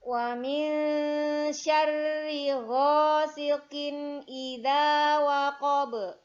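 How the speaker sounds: slow, loud melodic chanting; Quran recitation. Shown as a demonstration. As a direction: chant it slowly like murottal. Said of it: "Ayat yang ketiga".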